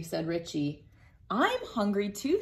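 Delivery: ordinary delivery, nothing marked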